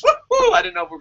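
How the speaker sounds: high whooping cheer; joyful woo-hoo; excited cheer. Real, not acted. Cheering a live comment.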